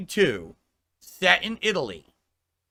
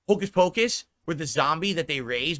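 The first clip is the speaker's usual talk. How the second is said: ordinary talk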